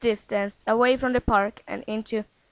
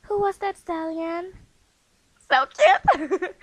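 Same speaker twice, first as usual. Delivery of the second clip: gushing filly; 7 semitones higher than usual. Acted. Filly gushing over stallion.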